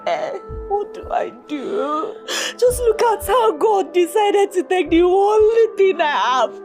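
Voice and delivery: crying through the words; tearful despair. Acted. Bereaved woman asking Chika what to do, tearful. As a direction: plead helplessly through tears.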